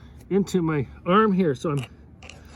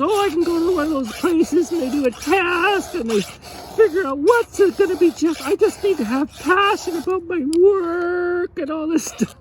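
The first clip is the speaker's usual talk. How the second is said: mimicking a naive young helper; mock young girl; 11 semitones higher than usual. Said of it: "so she goes".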